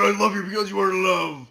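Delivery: ordinary delivery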